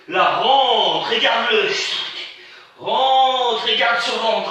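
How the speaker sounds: stretched urging cue; slow, drawn-out coaching. Real, not acted. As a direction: draw out each word to push.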